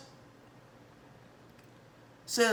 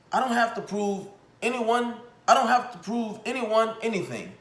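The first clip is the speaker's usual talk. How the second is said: mock Antonio Brown quote; 6 semitones higher than usual. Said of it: "is what he said".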